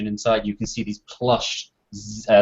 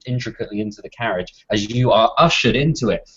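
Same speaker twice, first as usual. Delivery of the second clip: ordinary talk, nothing marked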